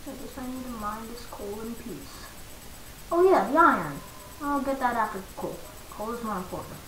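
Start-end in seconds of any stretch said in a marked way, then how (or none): 0.1-2.3 unvoiced murmured whisper. Real, not acted. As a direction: whisper to yourself while mining. Whispering while mining.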